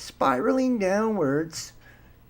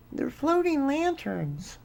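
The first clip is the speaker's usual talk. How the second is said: higher and softer, airy sarcastic "They're floating lanterns."; mock dismissal; 8 semitones higher, 6 dB quieter than usual. Host sneering at people who call them lanterns, soft mock. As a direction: toss it off lightly as a sneer. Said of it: "have you noticed that men's hearts will fail them".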